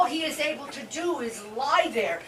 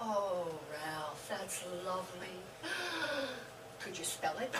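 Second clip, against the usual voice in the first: hushed surprise; 7 semitones lower, 11 dB quieter than usual. Acted. Quiet touched reaction to proposal.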